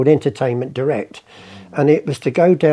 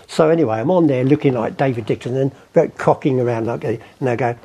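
ordinary delivery

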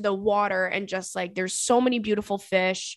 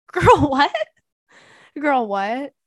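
laughing disbelief; 5 semitones higher, 6 dB louder, slower than usual. Real, not acted. Laughs at own embarrassing story.

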